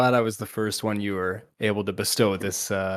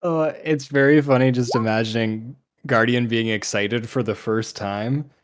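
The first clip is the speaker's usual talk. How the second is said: normal talking voice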